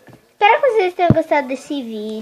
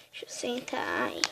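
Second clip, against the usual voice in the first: soft whispered aside; whispering; 14 dB quieter than usual. Real, not acted. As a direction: murmur it under your breath.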